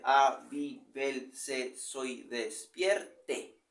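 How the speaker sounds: slow, stretched, every syllable counted. Shown as a demonstration. Teacher counting syllables of the verse for students, slowly. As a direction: drag the line out to count it.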